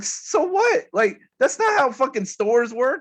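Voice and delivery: indignant high yelp at the Apple clerk; retold outrage. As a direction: fire back at the clerk in disbelief. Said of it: "So I'm getting all pissed off".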